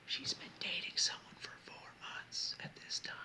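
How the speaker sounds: unvoiced-sounding secret whisper; hushed, emphatic stage whisper; whispered aside. Real, not acted. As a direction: whisper it like a confidential reminder.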